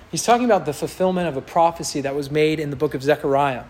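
usual speaking voice

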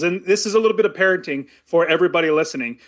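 usual speaking voice

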